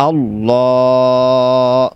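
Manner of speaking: আল্লাহ stretched very long with a thick L; heavy drawn Allah. Shown as a demonstration. Teacher modelling the thick L of আল্লাহ slowly. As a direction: open the mouth wide and hold আল্লাহ. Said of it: "মুখকে বড় করে মোটা করে ফুর করে পড়তে হবে".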